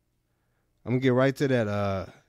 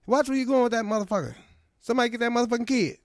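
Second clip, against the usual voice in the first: high, fast mock Jackson outburst; Samuel L. Jackson; 10 semitones higher, faster than usual. Comic voicing the actor hit by a cart, high fast outburst.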